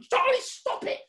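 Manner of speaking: high-pitched, exasperated play telling-off; mock scolding. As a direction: pretend to snap at a naughty puppet. Scolding the misbehaving puppet.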